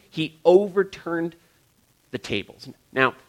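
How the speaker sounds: usual speaking voice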